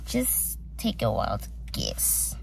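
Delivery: slow, hushed whisper; teasing whisper. Real, not acted. Whispers to viewers to guess the plan. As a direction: lean in and whisper it slowly, teasing.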